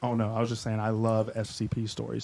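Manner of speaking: normal talking voice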